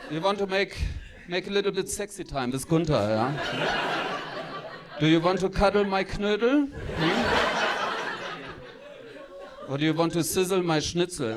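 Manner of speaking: lightly voiced German lover, hammed-up; mock seduction in a German accent; German dirty talk. Gunther woos before sexy time, comically German-accented. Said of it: "the dirty talk".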